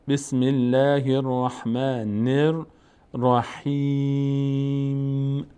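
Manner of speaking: very slow, measured tajweed chanting with long held vowels; Quranic recitation. Shown as a demonstration. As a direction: recite deliberately, holding the final syllable long. Teacher models slow Quranic recitation. (تلاوت شمرده و کشیده با تجوید)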